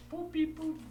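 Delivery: high playful beep boop sounds; beeping noises. Acted. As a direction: chirp like a little machine. GM imitates computer beeps.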